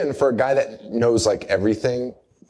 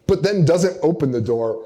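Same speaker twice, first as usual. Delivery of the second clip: ordinary talk, nothing marked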